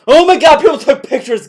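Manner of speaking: exclaimed loudly, high; loud mocking echo. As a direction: throw his words back loudly. Mocking, repeating co-host.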